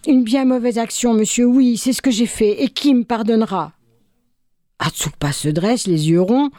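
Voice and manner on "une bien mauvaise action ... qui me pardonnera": coupable qui supplie, voix plus haute; suppliant. Acted. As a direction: implorer le pardon, un peu plus haut. Repentant voleur implore pardon. (voix suppliante et plus aiguë)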